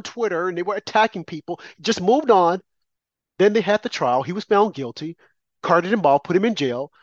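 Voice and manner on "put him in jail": normal talking voice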